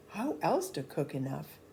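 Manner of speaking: ordinary delivery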